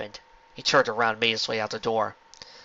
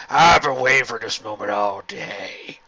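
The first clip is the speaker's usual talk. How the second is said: snapped; 4 dB louder, slower than usual. A narrator's reading. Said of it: "Hurricane snapped".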